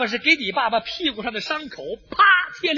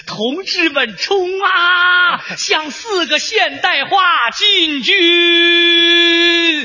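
rousing battle cry, very drawn out; high shout; 4 semitones higher, 4 dB louder, much slower than usual. Acted. Father rallying comrades at the front, loud shout. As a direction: bellow the charge, stretching the last word. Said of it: "我爸爸高喊".

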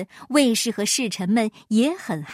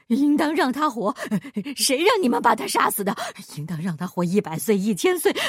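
mild mock frenzied yell; faster than usual. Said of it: "狂叫道".

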